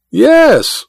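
mocking imitation of a friend's exclamation; mimicking Goodall's tone. A narrator's reading. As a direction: mimic the other man's tone back at him. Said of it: "mimicking Goodall's tone".

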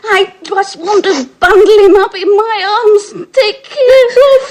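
gushing through tears, rising at the end; sobbing with tenderness for fragile Felix; tearful. Acted. Sister weeping over Felix.

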